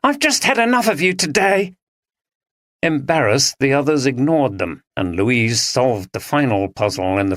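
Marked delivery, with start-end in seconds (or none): 0.0-1.7 exasperated. Acted. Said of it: "shouted Andrea".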